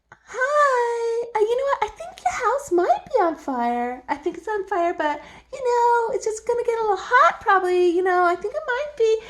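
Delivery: high, hesitant, overly polite mock warning; timid nice warning. To a neighbour whose house burns, mock timid. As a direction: tiptoe around the bad news in a squeaky voice. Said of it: "That's being nice".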